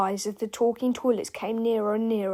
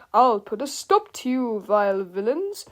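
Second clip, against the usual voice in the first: bold superhero declamation; fearless hero; 4 dB louder than usual. A narrator's reading. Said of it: "he said fearlessly".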